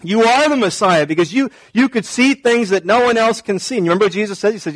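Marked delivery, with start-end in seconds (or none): 0.0-1.1 excited. Retold or imitated. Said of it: "He gets excited".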